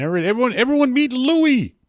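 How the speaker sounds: high, loud show-and-tell; excited introduction. Real, not acted. Host shows Louis's holiday picture to everyone, excited introduction. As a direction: sing out the introduction high and proud.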